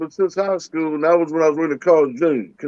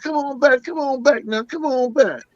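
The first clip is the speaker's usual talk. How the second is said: high, pleading come on back; pleading managers; 7 semitones higher than usual. Retold or imitated. Managers chasing him down the street, high pleading call. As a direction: beg him to return. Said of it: "came running down the street talking about".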